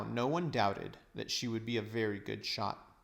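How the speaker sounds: plain reading voice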